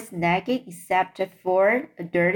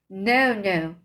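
ordinary delivery, nothing marked

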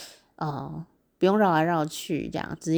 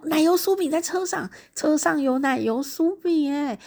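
excited, high-pitched discovery; 10 semitones higher than usual. Retold or imitated. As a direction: relive the thrill of a lucky find, pitched high. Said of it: "好兴奋哦。". (兴奋地提高音调)

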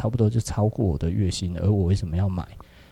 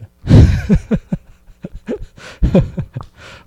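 a big burst of real laughter, high and airy; loud laugh; 13 semitones higher, 10 dB louder than usual. Real, not acted. Laughing at his own watch story.

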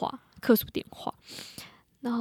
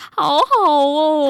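slow, high, loud envious drawl; 5 semitones higher, 11 dB louder, much slower than usual. Real, not acted. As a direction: stretch it out enviously. Envious drawn-out sigh.

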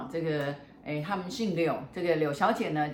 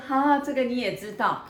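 caught-out surprise; 7 semitones higher, 4 dB louder than usual. Retold or imitated. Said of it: "她就说".

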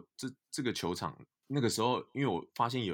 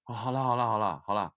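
giving in; 4 semitones higher, 8 dB louder than usual. Retold or imitated. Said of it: "我一哭，他就说".

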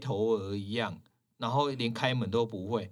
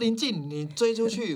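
higher, louder urgent call to the assistant; 10 semitones higher, 3 dB louder, slower than usual. Retold or imitated. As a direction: hurry her out the door. Urgently sending assistant after customer. (急忙喊助理追出去)

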